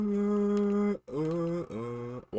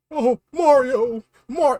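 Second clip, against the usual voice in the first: Luigi voice; 4 semitones higher, 7 dB louder, slower than usual. Acted. High Luigi voice.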